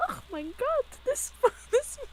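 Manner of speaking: very high German squeal breaking into laughter; high-pitched German impression; squeaky German voice. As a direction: try the squeaky German bit and crack up. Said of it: "It's like".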